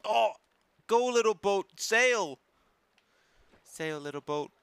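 loud, high cheering on. Pretend. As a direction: cheer the boat on proudly. Cheering a boat on.

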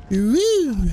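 loud high victory whoop; excited cheer. Real, not acted. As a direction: cheer with relief at escaping. Escaping zombies through door.

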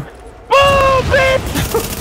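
very high triumphant yell; triumphant shout. Real, not acted. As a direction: crow over the explosion. Mine explodes.

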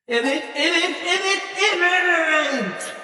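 high, drawn-out cheering "evet"; mother celebrating the end of the race; jubilant cheer. Real, not acted. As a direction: stretch out the celebration. Celebrating the win.

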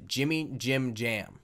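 usual speaking voice

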